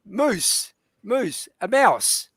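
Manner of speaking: "moose" said with an oo vowel to mean mouse. Shown as a demonstration. Explaining the pun to a host who missed it, slow and quiet. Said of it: "Did you get that?".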